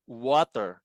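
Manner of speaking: "water" with a drawn-out oo glide, oo-ah-ter; W sound shown. Shown as a demonstration. Teacher demonstrates pronouncing W, "water" begun with a long oo. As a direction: stretch the W of "water" into oo. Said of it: "pagka binigkas mo yung W".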